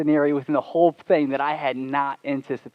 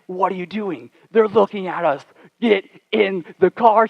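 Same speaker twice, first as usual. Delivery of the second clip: put-on mildly exasperated girlfriend, pitched higher; mock scolding; 5 semitones higher, 6 dB louder than usual. Girlfriend ordering him back into the car, mock scolding. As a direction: imitate an alarmed telling-off, ending slow and loud.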